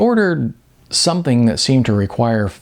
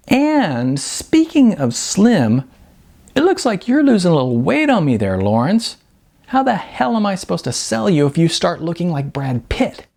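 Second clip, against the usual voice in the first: higher teasing mock scold; 7 semitones higher than usual. Beth teasing Larry about losing weight, mock scolding. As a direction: scold him playfully.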